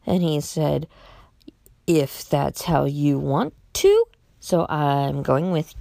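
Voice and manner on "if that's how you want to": slow, deliberate words with a questioning lift; coy hint. Retold or imitated. Utility worker hinting to a caller, coy. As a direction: draw it out, leaving the answer dangling. Worker hints without saying no.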